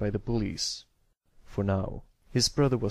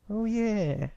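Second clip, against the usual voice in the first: sudden realization; 11 semitones higher than usual. A narrator's reading. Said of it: "with a sudden nod".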